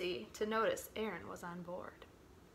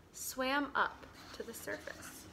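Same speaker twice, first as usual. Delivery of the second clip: hushed; 7 dB quieter than usual. A narrator's reading. Creatures rise quietly.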